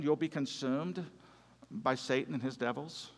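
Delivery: low, soft, unruffled reply; very calm. Retold or imitated. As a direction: answer calmly, quietly and evenly. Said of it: "very calmly".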